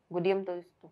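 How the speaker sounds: usual speaking voice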